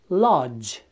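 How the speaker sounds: lodge ending in a hard DJ; English lodge. Shown as a demonstration. Teacher modelling English lodge, hard DJ ending. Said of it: "îl întâlnim și în cuvântul".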